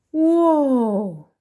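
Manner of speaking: be bowled over; amazed whoa. Acted. Storyteller amazed by the library.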